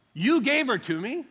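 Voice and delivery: high, loud accusing cry; indignant Adam. Retold or imitated. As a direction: fling the blame back at God. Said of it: "But he said".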